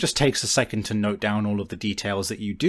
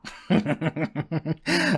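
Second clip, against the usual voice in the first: amused laugh; 6 semitones higher than usual. Acted. NPC laughs at her trouble.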